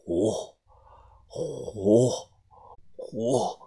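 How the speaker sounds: low, soft halting syllables; faint broken gasps. Acted. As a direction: gasp it out, barely alive. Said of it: "断断续续地说".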